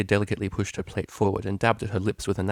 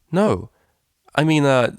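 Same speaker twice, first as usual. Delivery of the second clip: loud, quick protest; hasty no; 6 semitones higher, 7 dB louder, slower than usual. A narrator's reading. DJ loudly stopping her roommate from telling the waiter. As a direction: blurt it out to stop her. Vinyl stops Octavia quickly.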